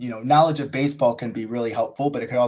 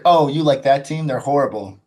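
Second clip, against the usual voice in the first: scoffing put-down, acted out; imitated scorn; 3 semitones higher, 7 dB louder than usual. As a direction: imitate a dismissive rival fan. Acting out rugby player's scornful reply.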